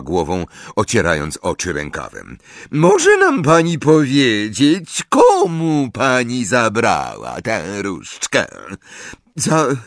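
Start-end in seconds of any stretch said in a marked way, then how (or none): 2.7-8.5 high, loud, drawn-out official voice; syrupy and pitched up, projected at the accused; raised-voice interrogator. A narrator's reading. Ambridge addresses Mrs Cattermole, raised high voice. 9.4-9.8 high, halting sob; sobbing. A narrator's reading. Accused witch questions the charge, sobbing and halting. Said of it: "załkała pani Cattermole".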